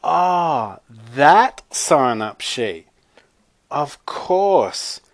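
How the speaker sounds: exaggerated dawning realization, drawn out; catching on. A narrator's reading. Zoe finally playing along with Chloe's lie, exaggerated realization. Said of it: "Zoe caught on".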